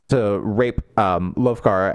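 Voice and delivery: ordinary talk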